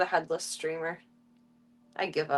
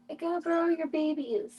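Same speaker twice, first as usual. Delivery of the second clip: higher-pitched cooing to an animal; pet talk; 5 semitones higher, 3 dB quieter than usual. Real, not acted. Streamer asking the animal mom for her babies, pet talk. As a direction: lift it into sweet pet talk.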